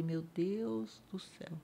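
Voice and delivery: soft, slow despairing Meu Deus do céu; broken down sigh. Retold or imitated. Crushed by the news, slow soft despair. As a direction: breathe it out, crushed. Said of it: "Aí eu desabei".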